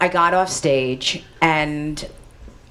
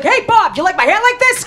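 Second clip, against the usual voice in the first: high, loud actor bursting in; mock a brash entrance, calling out to a pal; Harvey Keitel; 6 dB louder than usual. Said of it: "Harvey Keitel busts in the door".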